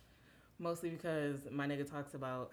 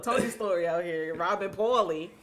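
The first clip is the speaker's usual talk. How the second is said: louder and higher, laughing as she teases; 5 semitones higher, 12 dB louder than usual. Real, not acted. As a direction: laugh through the teasing. Teasing through laughter.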